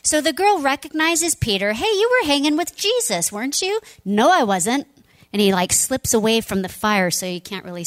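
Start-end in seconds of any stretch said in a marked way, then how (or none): 1.7-3.8 much higher, louder teasing accusation; accusing servant girl. Acted. 4.0-4.9 louder, quick brush-off; flat denial. Acted. Peter denying.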